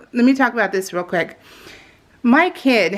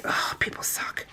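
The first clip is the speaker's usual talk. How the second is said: whispered, much softer bitter aside; 12 dB quieter than usual. Real, not acted. To viewers about ungrateful in-laws, whispered. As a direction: breathe it out in a whisper.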